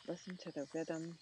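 usual speaking voice

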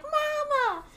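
very high chick cry of mama; little Mumble calling. Retold or imitated. Baby Mumble crying out for his mother. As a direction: wail for your mother like a chick. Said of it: "Mumble's like".

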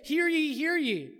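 loud, high herald cry; town crier. Acted. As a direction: call out like a herald. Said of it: "when we say".

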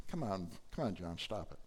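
elder quietly calming weeping John; soft coaxing. Retold or imitated. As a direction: gently talk him down. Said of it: "The elder says".

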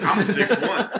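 high, loud, drawn-out protest; exasperated exclamation. Retold or imitated. Comic telling how tall the window was, exasperated exclamation. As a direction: blurt it out loud and high, nearly laughing.

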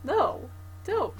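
high pleading voice; mock pleading. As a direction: beg them to stop, half-joking. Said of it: "And I'm like".

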